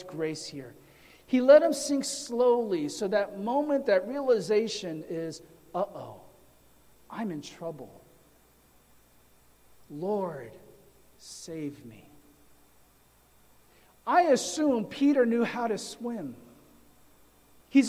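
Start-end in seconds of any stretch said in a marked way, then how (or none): 5.7-12.1 lower, softer, hushed mock panic; a mild frightened murmur, sinking away; understated fear, lower and softer, fading to the end; Peter's quiet plea. Peter realizes he's sinking.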